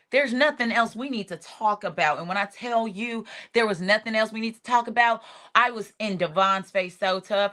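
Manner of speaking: ordinary delivery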